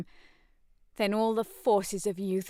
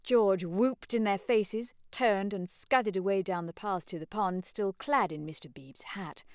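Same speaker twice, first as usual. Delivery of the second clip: ordinary delivery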